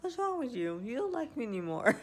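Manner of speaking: playfully acting offended. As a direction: ham up being offended as a joke. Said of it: "they're just acting offended".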